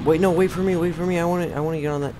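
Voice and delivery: high, insistent begging to wait; urgent pleading. Real, not acted. Calling after a departing vehicle.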